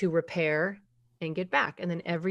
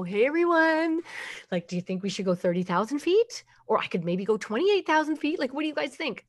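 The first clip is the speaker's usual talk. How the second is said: higher, breezy clueless pilot; indecisive captain; 6 semitones higher than usual. Retold or imitated. Captain polling passengers mid-flight, carelessly casual. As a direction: ask the passengers like it's no big deal. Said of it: "the captain decides he's gonna walk down the aisle and go".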